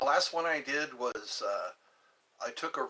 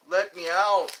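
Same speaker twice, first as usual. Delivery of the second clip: loud plea; 5 semitones higher, 9 dB louder than usual. Pretend. Pleading to be let out.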